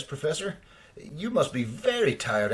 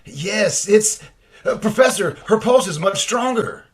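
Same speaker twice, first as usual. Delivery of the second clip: excited; 7 semitones higher, 8 dB louder than usual. Acted. Assistant reports rising pulse excitedly.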